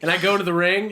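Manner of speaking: ordinary delivery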